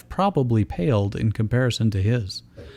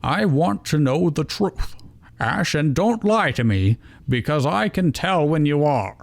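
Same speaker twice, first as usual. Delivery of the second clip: mild scolding; 5 semitones higher than usual. Acted. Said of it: "scolding note".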